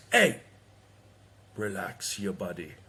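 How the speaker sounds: a hint of Jamaican, calm and laid-back; Jamaican accent. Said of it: "full Jamaican accent".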